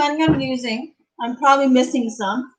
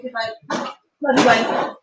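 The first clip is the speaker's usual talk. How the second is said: ordinary talk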